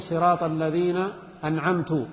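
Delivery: "أنعمت" said an'amtu, ending in u; damma on ta. Shown as a demonstration. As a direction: recite the slip with u on the t of "أنعمت". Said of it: "بضم التاء".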